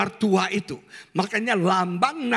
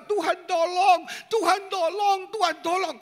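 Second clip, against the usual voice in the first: pleading prayer; 7 semitones higher than usual. Acted. Congregant pleading to God.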